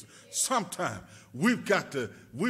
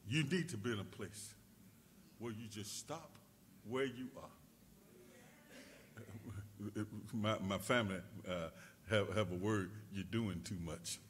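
much softer, low sermon voice; hushed preaching; 8 semitones lower, 13 dB quieter than usual. Acted. Preacher quieting the church to be still, hushed. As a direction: pull them in close and quiet.

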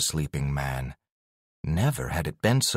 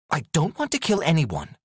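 higher-pitched, stern warning; 8 semitones higher, faster than usual. A narrator's reading. Alex warns the cat before the attack, sternly. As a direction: lay down a firm limit. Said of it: "she warned".

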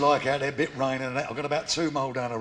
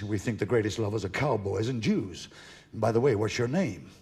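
put-on low-pitched American accent; American woman; 4 semitones lower than usual. American woman greeting a British man in the pub, low American accent. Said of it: "You're American".

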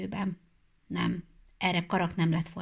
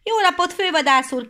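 loud greeting called out; loud shouted hello; 12 semitones higher, 9 dB louder than usual. Acted. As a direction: call the greeting across the field. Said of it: "kiáltott valaki".

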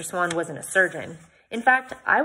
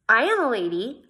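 defensive; 5 semitones higher, 6 dB louder than usual. A narrator's reading. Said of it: "I said defensively".

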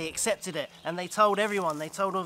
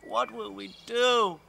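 tearful; 6 semitones higher, 4 dB louder, slower than usual. Acted. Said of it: "Osato was crying".

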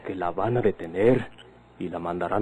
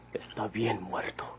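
whispered; 8 dB quieter, slower than usual. Acted. Grim news of a death.

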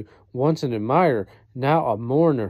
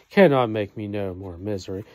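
ordinary talk

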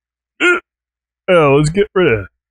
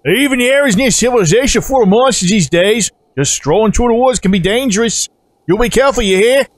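lightly voiced game villager with a lilt; hammed-up villager cautioning a traveler; folksy warning character; 6 semitones higher than usual. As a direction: give a friendly warning in a put-on character voice.

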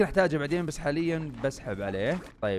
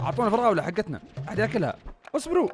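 excited; 6 semitones higher, faster than usual. Real, not acted. Slimes eating his strawberries.

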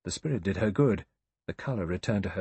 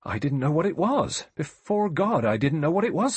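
loud, high-pitched protest; crying out; 8 semitones higher, 7 dB louder than usual. A narrator's reading. Rogers protests his innocence to the judge, crying out. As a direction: cry out your innocence, voice raised. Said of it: "Rogers cried".

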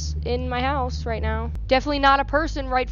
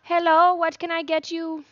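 bright, higher cashier greeting; lightly voiced chirpy counter clerk; shop clerk voice; 7 semitones higher than usual. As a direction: play the cheerful cashier. Cashier character greets customer.

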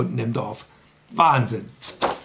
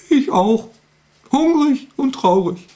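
sad fox voice; 12 semitones higher, 4 dB louder than usual. Acted. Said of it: "Sagt der Fuchs".

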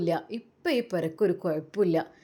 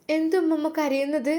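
put-on child voice; 6 semitones higher than usual. Child asks crying grandma.

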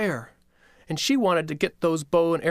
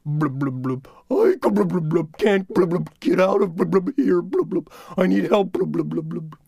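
bubbling words from underwater; gurgling underwater voice; 4 semitones higher, 4 dB louder than usual. Acted. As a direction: talk as if drowning in a stream. Coyote rock underwater.

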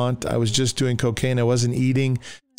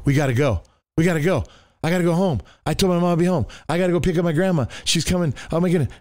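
higher-pitched imitation of flustered friends; mock panic; 6 semitones higher, faster than usual. Friends on acid making excuses to leave, mock panic. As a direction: act out jittery friends hurrying off.

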